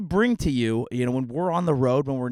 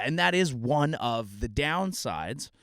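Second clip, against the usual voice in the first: ordinary talk, nothing marked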